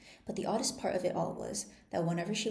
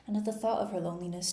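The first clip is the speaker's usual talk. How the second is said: usual speaking voice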